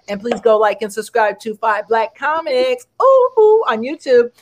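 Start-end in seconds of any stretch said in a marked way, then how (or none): 3.0-3.6 high, excited squeal. Real, not acted. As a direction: squeal with delight.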